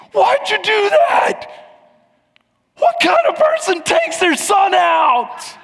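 slow, high, outraged mock yell; freaking-out shout. Beginner son freaking out at his dad, outraged shout. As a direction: wail it in drawn-out indignant protest. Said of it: "he starts freaking out".

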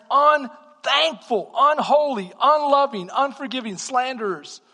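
loud, slow, drawn-out preaching; fiery preacher drawing out each sin; preaching at full force. Acted. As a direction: pound out each word. Preacher building a list.

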